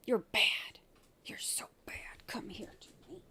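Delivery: unvoiced teasing whisper. Real, not acted. Owner whispering to her dog, teasing whisper.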